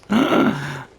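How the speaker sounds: sharp dramatic gasp. Pretend. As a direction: gasp in mock dread. Dreading the final boss.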